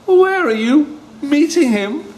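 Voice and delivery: the fox losing his nerve; nervous fox. Acted. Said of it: "fox asks nervously".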